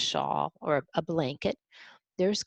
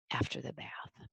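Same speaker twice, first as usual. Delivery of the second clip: whisper; 4 semitones lower, 12 dB quieter than usual. Real, not acted.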